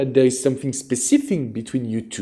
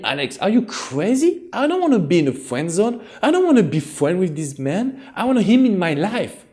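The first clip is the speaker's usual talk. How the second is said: high-pitched put-on voice of the outraged viewer, all the way through; mock viewer protest; 7 semitones higher than usual. Coach voicing a viewer refusing the friend zone, high, mock indignant. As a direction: play the viewer who thinks the coach is crazy. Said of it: "And you will tell me".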